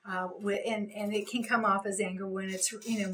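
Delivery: ordinary talk, nothing marked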